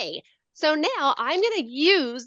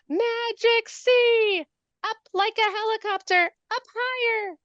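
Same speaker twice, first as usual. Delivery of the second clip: much higher, slow playful chant of the strokes; sing-song the stroke names for kids; silly high voice; 9 semitones higher than usual. Shown as a demonstration. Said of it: "Should I use a silly voice?".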